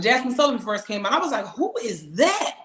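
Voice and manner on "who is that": slow, drawn-out, higher amazed reaction; retold amazement. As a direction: re-enact being struck by a new singer, stretching the words. Quotes own reaction on discovering singer.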